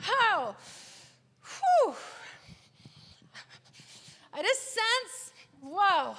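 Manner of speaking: normal talking voice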